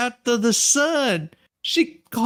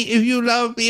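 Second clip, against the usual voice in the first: ordinary talk, nothing marked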